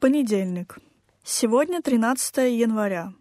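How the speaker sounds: usual speaking voice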